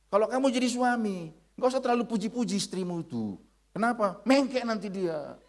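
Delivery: high-pitched mock Batak accent. Batak man advising a husband about his wife, comic Batak accent. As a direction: give blunt advice in a Batak accent. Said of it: "Orang Batak itu bilang begini".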